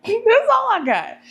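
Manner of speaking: high laughing repeat, slowed down. Shown as a demonstration. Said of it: "repeated with laugh".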